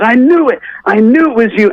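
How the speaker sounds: high-pitched acted "gotcha" excitement; excited producer. Producer recognizing a supposed celebrity on Skype, excited. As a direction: play a producer thrilled at being proved right.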